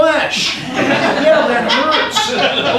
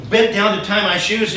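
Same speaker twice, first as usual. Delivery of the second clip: usual speaking voice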